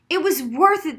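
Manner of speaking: loud, high outburst; exploding with pride; bursting out. A narrator's reading. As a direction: blurt it out, fired up. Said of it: "the shorter Daniel exploded".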